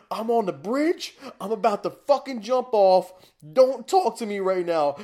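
desperate shouting from the bridge; hysterical shouting. Retold or imitated. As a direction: yell it like you're about to jump. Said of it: "I'm like".

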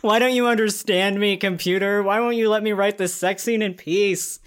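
exasperated plea. Pretend. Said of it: "imagining you muttering in a corner".

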